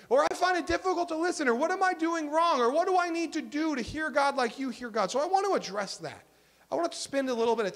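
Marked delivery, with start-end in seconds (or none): 0.2-1.5 quick, higher imitated gripe; mild complaint. Churchgoer confessing trouble to the pastor, mild complaint. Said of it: "questions or complaints that I get". 1.5-5.1 higher-pitched imitated questioning; mild complaint. Churchgoer asking the pastor for help, mild complaint. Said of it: "questions or complaints that I get".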